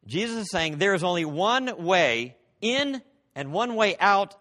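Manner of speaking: usual speaking voice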